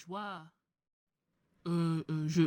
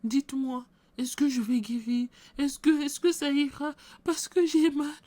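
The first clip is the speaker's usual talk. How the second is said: much higher, worried pleading voice; anxious sick woman; 7 semitones higher than usual. Acted. Sick woman asks the pastor if she'll heal, high and anxious. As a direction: beg for reassurance, pitched up and pained.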